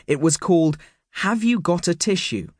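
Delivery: plain reading voice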